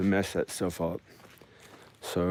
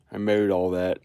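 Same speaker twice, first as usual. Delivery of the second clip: Roanoke accent. Shown as a demonstration. Vlogging about her lawn, in a light Roanoke accent. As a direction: stretch "mowed" the way locals in Roanoke do. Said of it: "I said it in a Roanoke voice".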